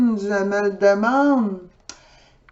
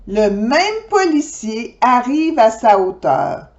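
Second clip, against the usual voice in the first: usual speaking voice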